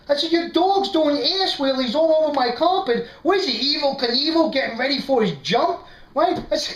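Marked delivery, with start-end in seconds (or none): 0.3-5.8 high-pitched, indignant complaint to a friend; worked-up comic exasperation, pitched high; retold flip-out. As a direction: rant in mock outrage about his dog. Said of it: "I flip out. I'm yelling at the dog".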